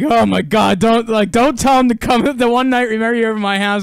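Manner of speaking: mock a wife's loud, pleading complaint; exasperated wife. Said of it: "his wife will be like".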